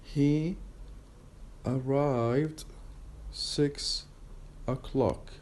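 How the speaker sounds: word by word, long pauses between words; He ... arrived ... six ... o'clock, each word set apart; very slow. Shown as a demonstration. Teacher pronouncing the sentence slowly first for students, very slow. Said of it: "slowly first".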